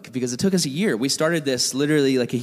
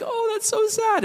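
much higher, slow pitying voice for his wife; wife's voice. Retold or imitated. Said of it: "So she's like".

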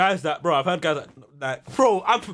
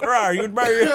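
laughing mock Irish; 5 semitones higher, 3 dB louder than usual. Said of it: "How the Irish man talk".

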